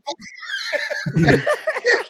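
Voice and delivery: high burst of laughter. Real, not acted. Laughs at the ribbing.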